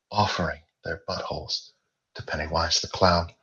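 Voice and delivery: plain reading voice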